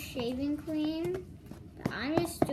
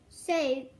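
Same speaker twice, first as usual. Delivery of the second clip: usual speaking voice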